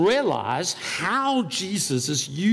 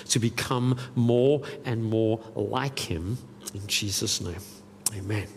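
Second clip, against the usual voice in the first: prayer; 9 semitones lower, 3 dB quieter than usual. Acted. Preacher leads the church in prayer before the sermon, low and softly. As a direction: pray earnestly over the congregation.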